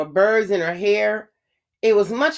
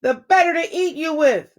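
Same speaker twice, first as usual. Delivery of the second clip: roar; 7 semitones higher than usual. A narrator's reading. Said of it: "roared the wolf".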